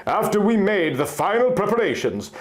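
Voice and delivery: ordinary delivery, nothing marked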